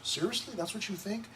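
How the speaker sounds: quiet, doubting challenge; incredulous. Real, not acted. Pastor challenging the grumbling people, soft incredulous rise.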